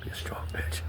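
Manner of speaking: whispered defiance, barely voiced; hoarse whisper. Real, not acted. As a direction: hiss it under the breath, insistent. Muttering his defiance to himself.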